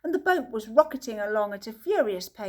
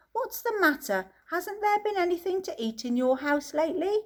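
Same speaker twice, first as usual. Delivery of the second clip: concerned Wonka; 8 semitones higher than usual. A narrator's reading. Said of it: "he said".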